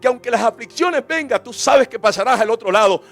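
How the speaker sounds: impassioned sermon building to loud; full-force preaching. Acted. Preacher vowing service to God before congregation, impassioned preaching. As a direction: drive the promise home to a loud climax.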